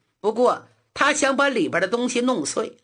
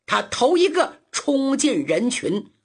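ordinary delivery

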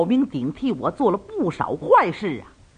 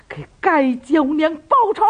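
raised, higher cry for revenge; angry outburst; 8 semitones higher, 4 dB louder than usual. Acted. Shi Changqing, pale with rage, demands revenge, shouting higher. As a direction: spit out the vow to avenge her. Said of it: "脸儿都气白了".

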